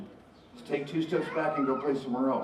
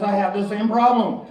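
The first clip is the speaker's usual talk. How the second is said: confident snake; 5 semitones higher, 5 dB louder than usual. Acted. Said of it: "And the snake says".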